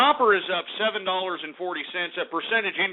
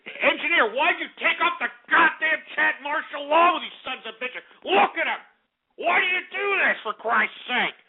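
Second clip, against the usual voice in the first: furious yelling; 9 semitones higher than usual. Real, not acted. Yelling at the engineer over chat martial law, furious. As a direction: rage at him, demand it back on. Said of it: "I'm frothing at the mouth out here 'cause I'm pissed".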